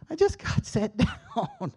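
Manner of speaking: high, drawn-out grumble with a laugh; mock complaint. Grumbling at mom's hospital request, mock complaint.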